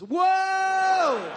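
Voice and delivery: high, loud, stretched whoa; sudden drawn-out holler; long shouted whoa. Acted. Sudden climax in impression.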